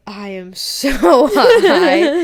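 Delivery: slow, high, loud laughing drawl; a friend laughing slowly at how high she is; laughing amazed. Retold or imitated. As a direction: stretch it out, giggling in disbelief. Realizing she's very high.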